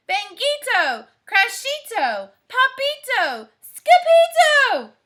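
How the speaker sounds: calling out. Acted. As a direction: call them down the hall. Mother calls out the names.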